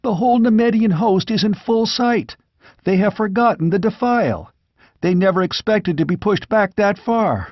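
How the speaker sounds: put-on young squire watching battle. Squire reports the battle to the wounded king, excited young voice. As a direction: call out the battle like a breathless lookout.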